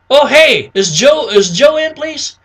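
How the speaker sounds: high, lively phone request; match a lively prospect, pitched right up; upbeat caller mirroring prospect. Shown as a demonstration. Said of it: "you wanna mirror it".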